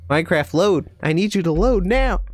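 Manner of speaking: loud, high demand at the screen; commanding yell. Real, not acted. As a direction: order the game to load. Yelling at the loading game.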